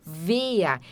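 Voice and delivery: "Wer" with the final R as a short "a", like "vea"; A sound at end. Shown as a demonstration. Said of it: "sonzinho de A no final".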